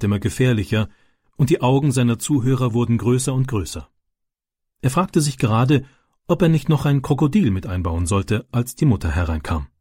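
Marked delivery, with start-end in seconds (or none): none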